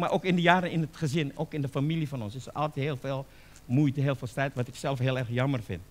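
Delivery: ordinary talk, nothing marked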